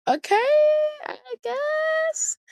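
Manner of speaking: squeaky high, sarcastic shrugging okay. Retold or imitated. Answering dad with a high, sarcastic okay. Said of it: "I'm like".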